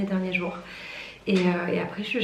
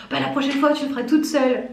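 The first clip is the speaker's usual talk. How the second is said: higher, louder huffy retort; mock offended; 5 semitones higher, 5 dB louder, faster than usual. Warehouse worker answering her joke, imitated as instantly offended. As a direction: imitate someone instantly miffed. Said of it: "le mec s'est immédiatement vexé".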